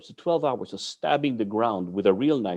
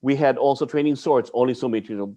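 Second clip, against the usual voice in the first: ordinary delivery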